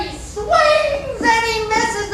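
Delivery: loud, high, stretched-out play-by-play; dramatic storytelling. Acted. Storyteller telling a crowd of the boy's swing, dramatically. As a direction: draw out each action, high and loud.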